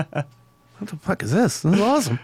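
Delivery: slow, drawn-out mock disbelief; pleasantly stunned band; amazed reaction. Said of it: "We're like".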